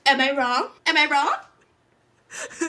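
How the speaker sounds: high, loud, the last one stretched very slow; imitating the talk-show host hitting back at the boos; Wendy Williams impression. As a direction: ham up the host's catchphrase. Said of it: "And she's like".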